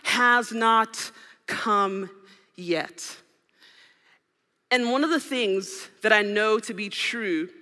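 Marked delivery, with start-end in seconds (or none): 0.0-3.2 very slow, weighty delivery; slow solemn. Retold or imitated. Jesus answers his mother, very slow and weighty. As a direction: let each word land slowly. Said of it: "He says".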